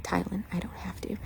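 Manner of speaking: soft, breathy mock sass; sassy whisper. A horse sassing Tylen, whispered mock defiance. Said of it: "he is just being so sassy".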